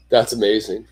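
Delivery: ordinary talk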